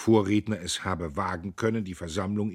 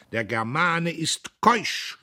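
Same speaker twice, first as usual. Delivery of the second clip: higher, louder, very slow proclamation; proud cry; 9 semitones higher, 6 dB louder, much slower than usual. A narrator's reading. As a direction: declare it to the crowd. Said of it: "rief Diederich".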